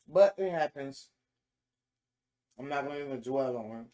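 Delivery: talking with mouth full; mid-bite speech; chewing food. Real, not acted. Seen 1: Food put in mouth at -4; lips closed, chewing through words.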